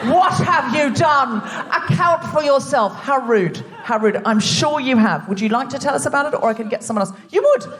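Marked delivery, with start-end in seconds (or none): 0.0-3.0 mock interrogation. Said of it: "point to people and go". 7.3-7.7 surprised delight. Real, not acted. Surprised at volunteer.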